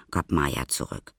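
ordinary delivery

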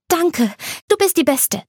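put-on high, girlish thank-you; Anna, bright and grateful. Anna thanking her best friend Light, high and gushing. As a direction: gush your thanks like an excited friend.

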